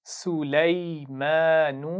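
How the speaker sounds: drawn-out, melodic tajweed recitation; slow Quran recitation. Shown as a demonstration. Teacher reciting slowly for pupils to repeat. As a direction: chant each syllable slowly for the class to copy. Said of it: "अगला लफ्ज़ पढ़ते हैं".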